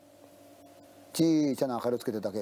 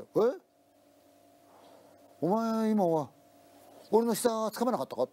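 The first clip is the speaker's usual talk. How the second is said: startled question, pitched higher; surprised; 7 semitones higher, slower than usual. Retold or imitated. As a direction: ask it startled, voice up high. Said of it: "同僚が驚いた声でもって". (驚いて高めの声で問いかける)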